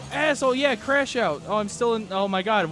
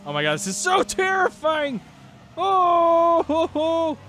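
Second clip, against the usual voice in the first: terrified high-pitched yelp; high panicked squeal; 10 semitones higher, 4 dB louder, slower than usual. Real, not acted. Near crash in a race.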